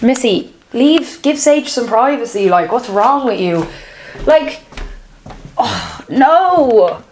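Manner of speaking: ordinary delivery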